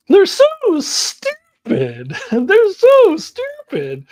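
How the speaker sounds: high, loud, very drawn-out mock disdain; imitating Australian girls. Echoing the exchange students' verdict, loud high hammed-up imitation. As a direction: squeal it slowly, hamming up the visitors. Repeats the girls' mocking line.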